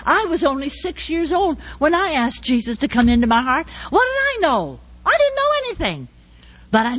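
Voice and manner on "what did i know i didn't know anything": high, bright rhetorical question; self-mocking. Real, not acted. Preacher mocking her child self, high rhetorical tone. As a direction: toss it up high and light. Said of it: "self-mocking question".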